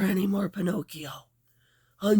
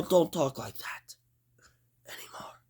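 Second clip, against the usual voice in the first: normal talking voice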